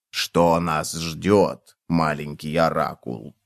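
low, very slow hushed voice; mysterious. A narrator's reading. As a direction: ask the oracle slowly, low and softly. Cockroach asks magic oracle.

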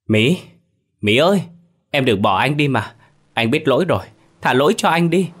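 desperate screaming. Acted. Madman runs into the street screaming for his dead lover. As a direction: scream her name, begging her not to leave. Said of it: "Miệng anh liên tục gào thét".

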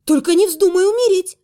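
loud, high urgent plea. Acted. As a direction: beg him sharply. Heroine pleads urgently.

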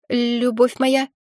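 inquisitive bird, rising question; questioning parrot voice. A narrator's reading. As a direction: ask it like a curious pet parrot. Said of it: "вопросительно поглядел". (вопросительный птичий голос)

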